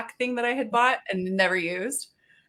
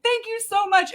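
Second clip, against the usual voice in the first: high, gushing thanks; delighted thanks; 13 semitones higher, 3 dB louder than usual. Retold or imitated. Cashier thanking the customer, high and gushing. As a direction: gush with delighted gratitude. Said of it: "this big old scream".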